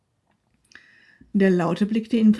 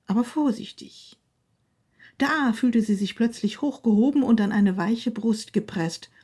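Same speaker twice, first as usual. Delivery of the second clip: plain reading voice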